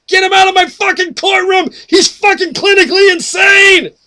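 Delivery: high, screaming courtroom outburst; outraged judge's shout. Acted. Judge ordering a madman out of court, furious screaming. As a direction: scream it at full volume as an enraged judge.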